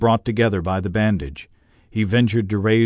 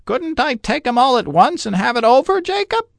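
put-on much higher, hopeful wheedling old man; hinting Scrooge; 3 dB louder than usual. A narrator's reading. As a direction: slip the idea in hopefully. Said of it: "hinted Scrooge".

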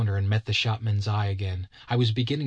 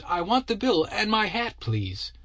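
high, raised demanding voice; louder note; 10 semitones higher than usual. A narrator's reading. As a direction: demand the bill firmly. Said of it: "on a rather louder note".

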